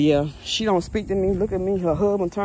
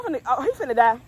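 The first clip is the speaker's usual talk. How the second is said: ordinary talk, nothing marked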